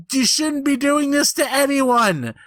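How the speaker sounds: high, hurt, pleading rebuke; whiny scolding. Retold or imitated. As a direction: scold her in a wounded whine. Retelling, scolding drunk girlfriend.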